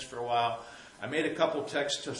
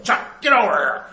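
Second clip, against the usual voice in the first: much higher, much louder call to the dog; retold yell; 8 semitones higher, 14 dB louder than usual. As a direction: yell for the dog across the path. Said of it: "she yells".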